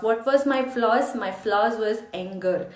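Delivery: usual speaking voice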